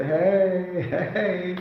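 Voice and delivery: high, drawn-out greeting hey; carefree weekend hello; 1 playful hey. Retold or imitated. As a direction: call out a breezy weekend hey.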